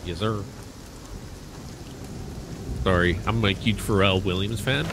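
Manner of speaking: a hint of a low demon voice; lightly voiced demon. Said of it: "that demon voice".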